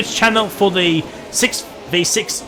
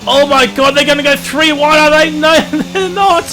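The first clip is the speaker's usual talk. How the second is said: excited shouting; 7 semitones higher than usual. Real, not acted. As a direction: yell as the cars go three wide. Three-wide chaos live.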